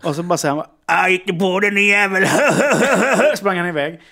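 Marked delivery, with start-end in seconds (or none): 1.0-2.3 loud, raised taunting voice; gloating attacker. Retold or imitated. Axe man after sparing his victim, loud taunt. 2.3-3.3 high, loud acted laugh; mocking cackle. Axe man running off, loud mocking laughter.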